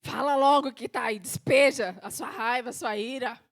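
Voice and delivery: loud, forceful order to confess; impatient demand. Acted. God answering a false prayer, loud impatience. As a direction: burst in loudly, demanding the truth.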